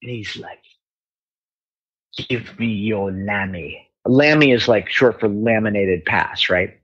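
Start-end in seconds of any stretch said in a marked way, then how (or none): 2.3-3.9 low, slow Cockney; imitated Cockney tough, slow and low; Cockney accent. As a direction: draw it out in a Cockney accent, softer. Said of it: "like, a Cockney accent".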